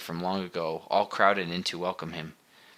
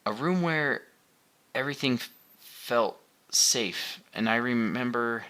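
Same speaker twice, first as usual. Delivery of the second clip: halting, higher, searching; struggling for words; 5 semitones higher than usual. A narrator's reading. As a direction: grope for the words. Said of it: "struggled to explain what he was remembering".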